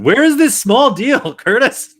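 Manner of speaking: cartoonish squeal for a trade; mock desperate plea. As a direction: beg Curtis for the trade, hammed up. Repeated mock plea, louder.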